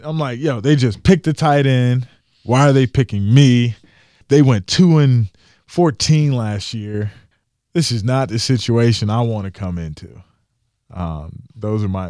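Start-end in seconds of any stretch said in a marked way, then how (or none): 0.4-10.2 higher, louder upset complaint; upset rant. Retold or imitated. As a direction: vent the disappointment at being picked. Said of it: "I'm upset".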